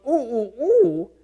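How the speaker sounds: rising hoots ending very high; monkey alarm hoots. Retold or imitated. Lecturer imitates the monkey's warning call, rising high. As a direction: hoot like a monkey sounding the alarm. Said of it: "when that monkey goes".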